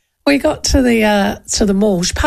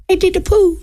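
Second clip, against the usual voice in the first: put-on little girl voice; 9 semitones higher than usual. Child repeats confession.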